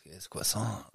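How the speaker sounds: "croissant" softly said as "kweh-sahn"; grandma's pronunciation. Retold or imitated. Co-host quoting grandma on the pastry, her French-like pronunciation. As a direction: say "croissant" with a KW start and no final T. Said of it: "She, she says".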